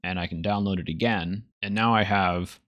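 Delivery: usual speaking voice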